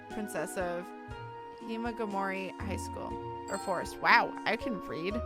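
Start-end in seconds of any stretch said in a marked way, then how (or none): none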